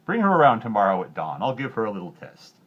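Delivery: loud commanding king; a greedy king setting a trap; greedy king's order. A narrator's reading. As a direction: order it with a sly edge. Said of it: "known far and wide for his greed".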